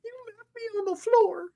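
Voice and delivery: high-pitched mock wife complaining; a soft, whiny imitation of the wife; imitating his wife. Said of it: "She's like".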